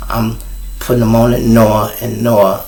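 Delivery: normal talking voice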